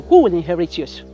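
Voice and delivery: loud jab at normal speed; pointed taunt. Acted. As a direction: put "who will inherit yours" to her pointedly. First asking of taunting question.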